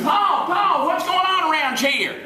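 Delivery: put-on high, loud panicked scream of a mountain girl; screaming daughter. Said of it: "She come running out screaming".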